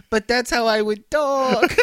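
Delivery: high, slow, hammed-up Mexican accent; whiny Mexican accent. Still teasing his coworker, exaggerated Mexican accent. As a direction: drag it out in a mock Mexican accent. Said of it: "And I'm like".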